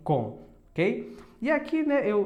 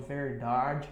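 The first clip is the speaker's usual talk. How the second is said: verdade and carteira with a curled R; strong caipira R; 3 semitones lower, 3 dB quieter, slower than usual. Shown as a demonstration. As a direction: lean into the country R. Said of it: "soa bem forte".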